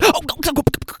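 high, eager mock voice; imitating her. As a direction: mimic her chatty rush. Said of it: "And she's like".

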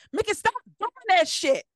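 high, loud mock scold; mama snapping at her teasing child. Mother scolding her daughter on the phone, high mock scold. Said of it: "called my mom".

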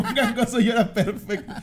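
slow, high, laughing through the words. Real, not acted. Repeating the joke to friends, laughing hard. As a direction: stretch it out, cracking up.

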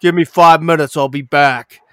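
loud mock action-movie line. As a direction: deliver it like a cool action hero. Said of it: "He's like".

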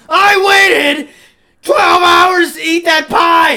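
put-on teenage son screaming at his dad over the pie; furious yell. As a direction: scream every word of the grievance. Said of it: "I was like".

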